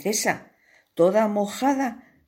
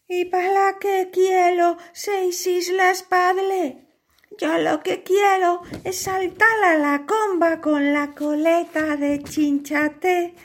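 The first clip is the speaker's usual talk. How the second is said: put-on much higher, girlish pleading; pleading princess; 12 semitones higher than usual. Princess begs her father, the king, high and pleading. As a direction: beg your father sweetly.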